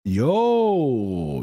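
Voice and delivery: normal talking voice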